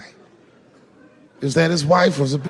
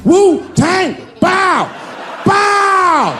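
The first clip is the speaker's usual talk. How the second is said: high loud chant; battle cry; 7 dB louder, much slower than usual. Acted. Friends hyping themselves up, loud high chant. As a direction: shout it like a war cry.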